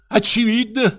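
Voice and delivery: high indignant cry; the director crying out against the brute; cried out. Acted. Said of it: "крикнул Жестяков".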